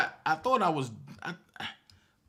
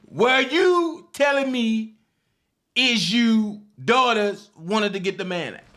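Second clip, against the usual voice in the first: Dabrowski voice; 4 semitones higher, 6 dB louder than usual. Retold or imitated. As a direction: stretch the mockery out after laughing. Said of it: "in my Dabrowski voice".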